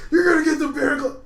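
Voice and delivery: loud, high hammed-up yell; hammed-up rescuer shouting; mock hero yell. S0 retelling the hero bursting in, loud and high.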